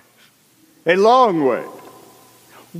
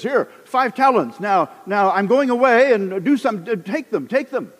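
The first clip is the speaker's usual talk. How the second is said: a lightly voiced master, pitched higher, brisk; mock master; 7 semitones higher than usual. Master giving his slave the talents, lightly voiced. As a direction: hand over the money with airy grandeur.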